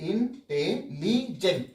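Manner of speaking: Intelligent in four separate slow beats; slow syllable breakdown. Shown as a demonstration. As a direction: pause between ইন, টে, লি, gent in intelligent. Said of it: "দুবারে উচ্চারিত হচ্ছে". (Intelligent চার ভাগে ধীরে ধীরে)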